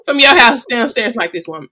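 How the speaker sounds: loud, quick indignant talk-back; indignant protest. Retold or imitated. Daughter to her mother, loud indignant protest. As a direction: push back at being summoned. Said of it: "I'm like".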